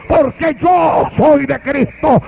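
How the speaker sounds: slow, shouted declaration; triumphant shout. Acted. Old father to his son, slow triumphant shout. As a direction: shout it out as a final victory.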